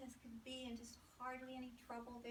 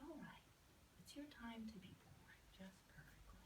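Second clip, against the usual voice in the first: soft guiding whisper; 9 dB quieter than usual. Pretend. Therapist softly guides the rebirth.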